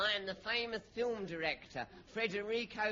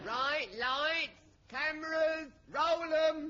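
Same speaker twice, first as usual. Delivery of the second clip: louder and higher with each word; calling out orders; 8 semitones higher, 7 dB louder, slower than usual. Acted. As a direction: bark the studio cues across the set. Director calling out on set.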